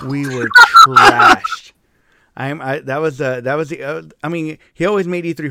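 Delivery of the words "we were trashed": loud, long drawn-out TRASHED; much louder, slow emphatic exclamation; emphatic repeat. Real, not acted. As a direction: belt out TRASHED, stretching it slowly.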